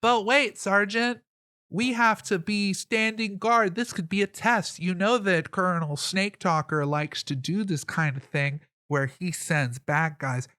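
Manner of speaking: mildly yelled objection. Acted. Wary guard challenging his sergeant about strangers, mild yell. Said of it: "you hear a guy yell out".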